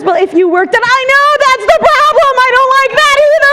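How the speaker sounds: high, fast, loud mocking whine; inner critic voice. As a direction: rattle it off high and shrill. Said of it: "She goes".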